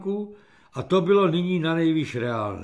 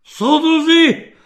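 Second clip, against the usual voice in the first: high, loud, drawn-out alarmed call; cracking agitated shout; 11 semitones higher, 10 dB louder, slower than usual. A narrator's reading. Captain bursts in to alert his comrades, cracking shout. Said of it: "který mu povážlivě přeskakoval".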